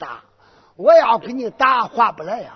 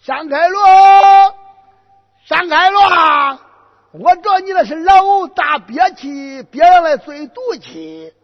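high mocking yell; 9 semitones higher, 10 dB louder than usual. Boy mocking general holding breath.

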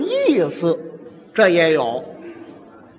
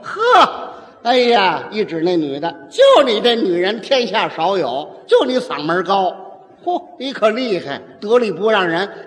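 wrong peacemaker scolding. Acted. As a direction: blame the woman, voice rising. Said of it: "哪有这样说的".

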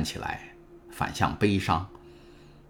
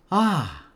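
sharp high excited cry; high joyful exclamation; excited gasp; 12 semitones higher, 5 dB louder than usual. A narrator's reading. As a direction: gasp with sudden joy. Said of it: "因兴奋而涨得通红".

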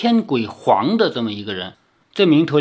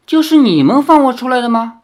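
contemptuous; 9 semitones higher, 7 dB louder, faster than usual. Acted. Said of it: "就是很看不起的样子".

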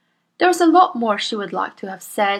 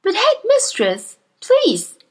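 put-on higher, earnest young teacher voice; Miss Honey; 13 semitones higher, 5 dB louder, slower than usual. Young teacher protests to her headmistress, earnest and higher. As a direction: politely protest to a bully.

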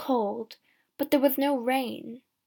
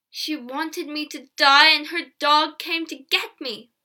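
rising accusation; 5 semitones higher, 8 dB louder than usual. A narrator's reading. Stricken peddler accuses girl before villagers.

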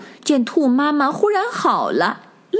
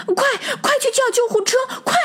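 much higher, fast panicked shout; urgent yell; faster than usual. A narrator's reading. True rabbit shouting for an ambulance, high and fast. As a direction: scream for help, racing. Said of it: "一边大叫".

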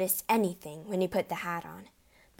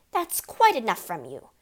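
scolding; 3 semitones higher, 8 dB louder than usual. A narrator's reading. Mother scolding daughter.

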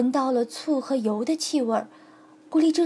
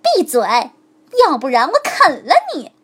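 fast, harsh threat; sharp shout; 2 semitones higher, 9 dB louder than usual. A narrator's reading. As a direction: snap at the bone. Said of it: "狐狸厉声叫道". (狐狸厉声威胁骨头)

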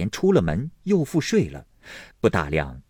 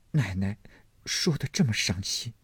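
softer, hurt little voice; holding back tears; 6 dB quieter than usual. Acted. Maid to her sick mistress after weeping, subdued and sad. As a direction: plead softly after crying. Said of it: "连忙止住哭".

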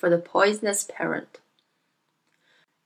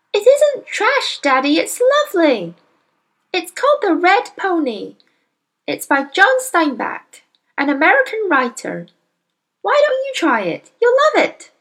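put-on much higher, louder girl's voice pleading; earnest little girl; 10 semitones higher, 4 dB louder than usual. Young girl defending her book to her father, earnest. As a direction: try to win him over, eager.

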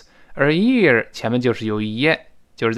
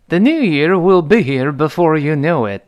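clearly projected English model reading; model sentence; 3 semitones higher, 4 dB louder than usual. Shown as a demonstration. Teacher models a dialogue line for learners, model sentence.

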